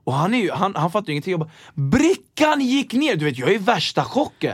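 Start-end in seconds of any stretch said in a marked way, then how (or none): 1.7-3.2 stunned joy. Retold or imitated. Thrilled the tray fits.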